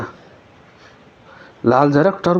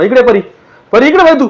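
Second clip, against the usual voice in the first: much higher, louder frantic call; pretend-frightened shout to Pari; 9 semitones higher, 4 dB louder than usual. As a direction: yell for her to turn round, playing scared. Mother calls Pari away from ghost.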